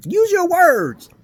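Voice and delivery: slow, high, loud scold; loud scolding shout. Retold or imitated. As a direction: bark it slow like to a child. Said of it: "I'm like".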